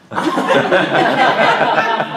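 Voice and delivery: high, loud burst of laughter. Real, not acted. Speaker cracking up over fake nails story, loud high laugh. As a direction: laugh out loud, really amused.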